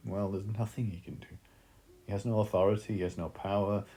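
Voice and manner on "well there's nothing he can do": ordinary talk, nothing marked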